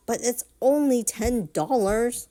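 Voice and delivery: loud, high stomping shout. Retold or imitated. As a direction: shout it like a tantrum. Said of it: "stomps her feet while shouting".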